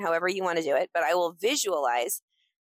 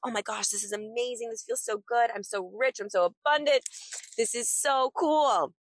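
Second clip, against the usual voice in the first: excited self-talk; 6 semitones higher than usual. Pretend. Celebrating an imagined check deposit, giddy and higher. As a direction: gush over the imagined check. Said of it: "I'm just like".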